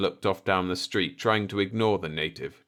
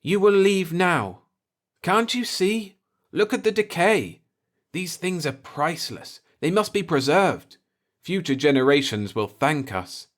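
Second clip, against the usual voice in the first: high, louder, urgent pleading; insistent voice; 10 semitones higher, 4 dB louder than usual. A narrator's reading. Speaker insists about the decay and the future, urgent and raised. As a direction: press the point hard. Shook his head and stamped.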